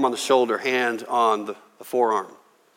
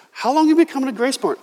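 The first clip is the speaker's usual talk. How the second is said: amazed question; 10 semitones higher, faster than usual. Retold or imitated. Lady asks visitor.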